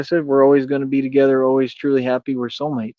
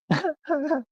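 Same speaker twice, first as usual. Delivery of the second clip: high laugh; 7 dB quieter than usual. Real, not acted. Laughs at the chat.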